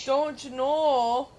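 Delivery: slow, drawn-out Minnesota "Don't you know?"; mock Minnesota drawl. Mocking Jace's Minnesota accent, slow and exaggerated. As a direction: stretch it like a Fargo local. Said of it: "He sounds so weird".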